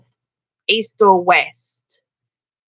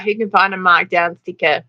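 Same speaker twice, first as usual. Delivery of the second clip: usual speaking voice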